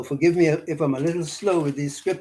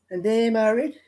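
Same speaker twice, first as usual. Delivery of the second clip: higher-pitched Bahamian gossip; Bahamian broad English; 6 semitones higher than usual. Shown as a demonstration. Local gossiping about Timnah and Eliphaz, high Bahamian vernacular. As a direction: lift it high in Bahamian dialect. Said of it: "That's how they say it".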